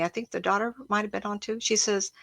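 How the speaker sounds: ordinary talk, nothing marked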